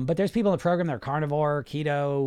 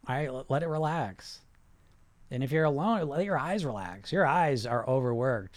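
guided relaxation. Acted. Coach guiding viewers to relax their bodies, soothing. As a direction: talk them down gently, unhurried.

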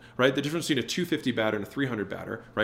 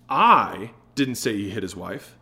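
I stretched and louder, the rest lower; stress on I; 3 semitones lower, 4 dB louder, slower than usual. Shown as a demonstration. Sales coach shows how emphasis changes meaning, stress on I. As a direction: lean long and hard on I. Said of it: "the emphasis that we put on the words".

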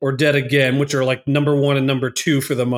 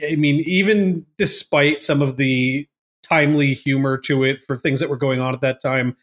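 ordinary delivery

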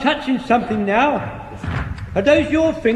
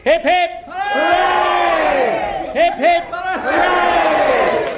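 cheering; 5 semitones higher, much slower than usual. Acted. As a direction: lead the cheers with gusto. Three cheers for the captain.

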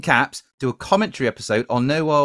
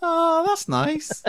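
high, slow, squeaky mock coo; drawn-out falsetto aside; mock high swoon; much slower than usual. Mock swooning at a wee joke.

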